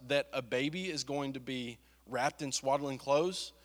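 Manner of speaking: normal talking voice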